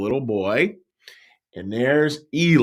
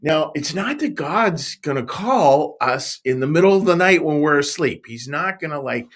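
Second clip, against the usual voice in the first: ordinary talk, nothing marked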